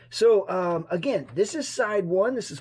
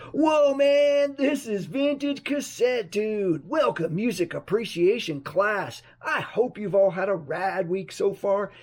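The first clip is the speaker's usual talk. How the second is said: surfer voice; 2 semitones higher than usual. Acted. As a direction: open like a chill surfer host. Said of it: "my surfer voice going".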